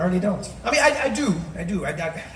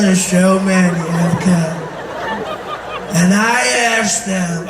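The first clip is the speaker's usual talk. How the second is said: loud, slow, swooping up and down; Donald Trump, erratic intonation; 6 dB louder, slower than usual. Acted. President rambling through a speech, slow swooping voice. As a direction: stretch the vowels and lurch unpredictably in pitch. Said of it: "just even his intonation".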